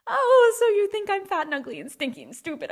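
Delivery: high, loud wounded indignation; mock outrage. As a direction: take wild offence, hammed-up. Acted insulted reply to switching English.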